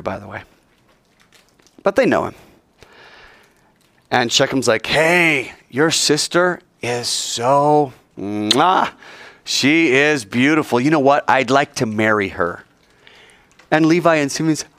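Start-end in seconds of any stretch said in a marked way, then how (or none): 4.8-12.6 a hint of a lovestruck suitor, slow on "so"; lightly voiced infatuated guy; smitten Shechem. Shechem telling the brothers he wants Dinah, lightly lovestruck.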